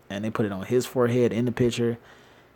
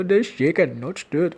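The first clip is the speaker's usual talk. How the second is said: higher-pitched mock Indian-Scottish accent; lilting joke Indian-Scottish accent, pitched up; Sean Connery; 6 semitones higher than usual. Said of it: "with an Indian Scottish accent".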